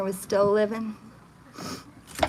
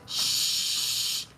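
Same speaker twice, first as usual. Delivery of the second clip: breathless hushing shh; imitated shush; 5 dB quieter than usual. Retelling a recording, imitating the man's hush. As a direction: shush like a man hushing a child. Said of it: "you hear him go".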